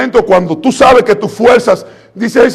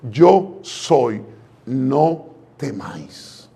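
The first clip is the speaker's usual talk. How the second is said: lower, softer, very slow and soothing; gentle reassurance; 7 semitones lower, 5 dB quieter, much slower than usual. Acted. Jesus reassuring frightened disciples in the storm, soft and slow. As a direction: hush them gently, a calming voice.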